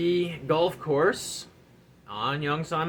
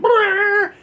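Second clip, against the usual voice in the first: high, loud engine brrr; loud revving-motor noise; 10 dB louder than usual. Retold or imitated. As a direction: rev it like a jeep. Imitating the jeep engine.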